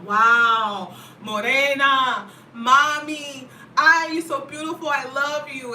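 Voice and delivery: catcaller. Retold or imitated. Men on the street catcalling her, mock loud flirty call.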